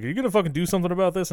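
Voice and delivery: fast, pushy demand; mock angry demand. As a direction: rattle it off at the mother in a rush. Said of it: "the dad's like".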